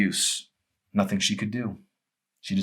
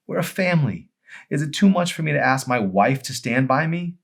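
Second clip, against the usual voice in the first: pleading husband; 9 semitones higher, 6 dB louder than usual. Acted. Joel pleading.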